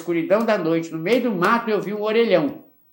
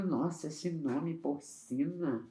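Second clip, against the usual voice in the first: awed, drawn out; 13 dB quieter, much slower than usual. Retold or imitated. Travesti awed by the name.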